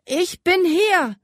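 put-on a scared boy forcing a firm voice; firm call. A narrator's reading. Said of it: "rief er mit so fester Stimme".

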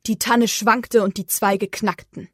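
ordinary delivery

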